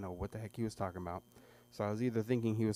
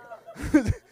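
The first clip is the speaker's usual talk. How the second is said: high loud laugh; 11 dB louder than usual. Real, not acted. Laughing at son.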